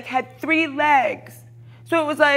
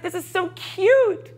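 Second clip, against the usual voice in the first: higher, slow mock coo; 9 semitones higher, slower than usual. Retelling her fake sweet comment, higher slow cooing. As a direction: coo it sweetly, a little mocking. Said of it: "And I was like".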